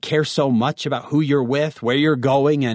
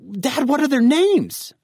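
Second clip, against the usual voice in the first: high, drawn-out exasperated question; exasperated teen; 13 semitones higher, 4 dB louder, slower than usual. Acted. Teen presses dad for the borrowers' names, exasperated. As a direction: stretch it out in disbelief at Dad.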